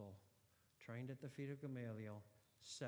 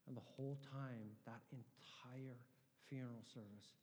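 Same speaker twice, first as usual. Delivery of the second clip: ordinary delivery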